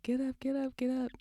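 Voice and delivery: high, repeated mock urging; urging mother. Mother to fallen son on the slope, mock urgent. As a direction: re-enact urging a child up, pitched high. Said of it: "And I'm telling him".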